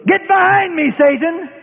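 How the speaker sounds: high, loud rebuke of Satan; Jesus rebuking Peter sharply; stern rebuke. Retold or imitated. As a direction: cry out the rebuke with force. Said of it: "Jesus said to Peter".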